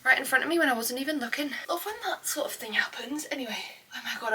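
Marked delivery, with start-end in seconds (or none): 1.7-4.3 breathless whisper; out of breath. Real, not acted. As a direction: speak while out of breath. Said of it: "I'm literally so out of breath".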